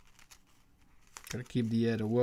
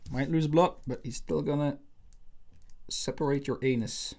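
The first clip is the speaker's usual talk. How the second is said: ordinary delivery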